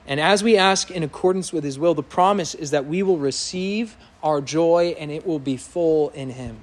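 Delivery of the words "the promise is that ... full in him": ordinary talk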